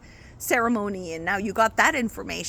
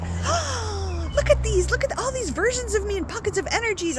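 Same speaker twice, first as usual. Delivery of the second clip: much higher, amazed and thrilled; delighted wonder; 12 semitones higher, 3 dB louder than usual. Acted. A seeker discovering her many selves, thrilled wonder. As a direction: gasp into wide-eyed discovery. Acting joyful self-discovery.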